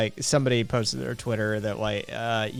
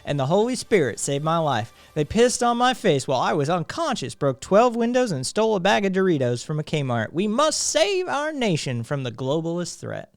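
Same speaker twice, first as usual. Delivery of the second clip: put-on Southern accent; 4 semitones higher than usual. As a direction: play a hammed-up Southern cop. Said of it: "needed to be said in a Southern accent".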